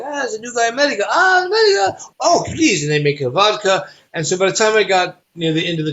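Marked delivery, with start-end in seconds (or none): 0.1-2.8 high, pleading, crying out America; excited drunk welcome. Retold or imitated. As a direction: beg the new guy to drink. Passengers welcoming him with vodka.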